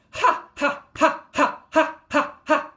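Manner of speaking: fast rhythmic loud "ha" shouts, pitched high; yelled ha. Shown as a demonstration. Showing chest-thumping exercise to students, quick yelled ha. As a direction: yell a quick steady volley of ha. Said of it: "this is another one that you yell".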